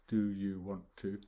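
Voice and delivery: "Do you want to" with every word distinct; four separate words. Shown as a demonstration. Teacher showing the unchunked version, four separate words. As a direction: keep "want" and "to" apart. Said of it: "four words".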